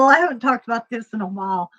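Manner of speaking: ordinary talk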